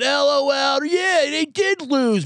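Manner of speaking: high, slow smug troll voice; mock smug commenter. Mocking a smug online commenter, high and drawn out. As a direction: sneer it like a gloating commenter. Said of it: "someone, like, replies underneath it".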